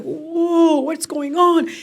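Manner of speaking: high, startled gasp of a question; arriving guest stunned by the drumming crowd, surprised; stunned surprise. Retold or imitated. Stunned by the huge welcome.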